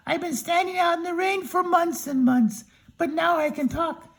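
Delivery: high, relieved Tin Man; storyteller voicing a high grateful Tin Man. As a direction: pitch up as the freed Tin Man.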